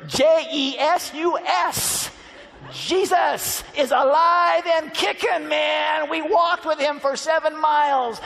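high, loud, triumphant shouting; jubilant shout. Acted. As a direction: spell it out then shout the news. Disciples shouting at the door.

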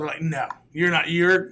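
ordinary talk

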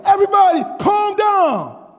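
put-on high, loud kid taking charge; confident little kid. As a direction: shout it out like a sure-of-himself child. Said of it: "A little, little six-year-old kid".

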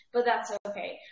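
ordinary delivery

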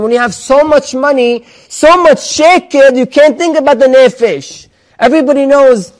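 rising preacher's fervor; impassioned preaching. Acted. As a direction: drive the point home to the congregation. Preaching raised against money.

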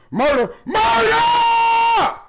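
high, very slow stretched shout; hollering murder after a big play, slowly drawn out; drawn-out victory yell. Real, not acted.

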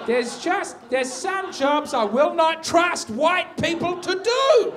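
high, loud, drawn-out protest; exasperated outburst. Acted. Comedian saying which jobs he will not trust them with, exasperated shout. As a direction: build to a slow indignant shout.

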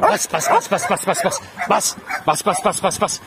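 quick string of urging commands; egging the dog on. Real, not acted. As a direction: chant the order quickly to spur on a dog.

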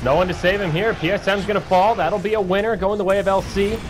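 high, lively play-by-play; excited casting. Acted. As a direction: call the fight as it happens. Live play-by-play.

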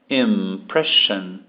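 "Impression" slowly, "im" like him, ending "shun"; slow pronunciation model. Shown as a demonstration. Teacher slowly modelling "Impression" for the class to repeat. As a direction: stretch out "Impression" so students can copy. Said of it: "digam aqui esse M como se fosse him".

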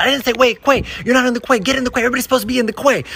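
high, rushed mock scolding; worked-up high-pitched complaint; yelling lady. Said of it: "there's a lady yelling".

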